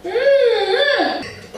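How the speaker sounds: squeaky high mm-hmm; laughing. Real, not acted. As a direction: hum it through laughter. After laughing fit.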